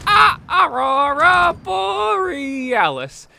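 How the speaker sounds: hammed-up Chalmers disbelief; slow, high, loud mock outrage; incredulous shout. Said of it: "as requested by Japai".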